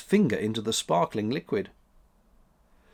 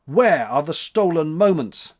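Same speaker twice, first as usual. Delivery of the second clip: louder demanding cry; 5 semitones higher, 6 dB louder than usual. A narrator's reading. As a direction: demand them back, loud. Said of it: "cried January".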